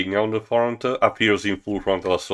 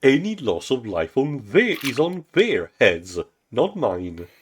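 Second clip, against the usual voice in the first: lightly voiced smug tyrant, higher; a touch of smug villain; 8 semitones higher than usual. President Zog gloating to his assistant, smug and higher. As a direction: savour the power, smugly.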